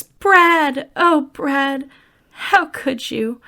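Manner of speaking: high, loud hammed-up wail of betrayal; mock dismay. Retelling Janet finding Brad with Frank, hammed-up heartbroken cry. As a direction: overplay a shocked, heartbroken cry. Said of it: "gasps and says".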